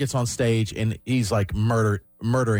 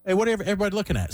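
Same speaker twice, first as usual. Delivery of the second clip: quick, higher call to the crowd; mock onstage call-out; 7 semitones higher, much faster than usual. Comedian stopping his set to ask the crowd: quick surprised call. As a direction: stop the show and ask the crowd. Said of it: "Kevin Hart goes".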